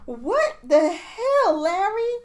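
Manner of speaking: drawn-out, high laughing outrage; slow mock outrage. Friend quoting the show at Larry, slow laughing outrage. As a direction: stretch it slowly, laughing, at Larry. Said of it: "It was like".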